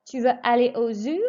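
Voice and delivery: tune climbs on zoo; French question, rising at the end. Shown as a demonstration. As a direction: let zoo rise clearly as a question. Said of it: "raise your voice just before the question mark".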